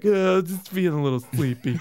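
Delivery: imitated groggy, knocked-out voice; mock-drowsy mumble of someone concussed; dazed friend. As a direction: play it woozy after a knock on the head.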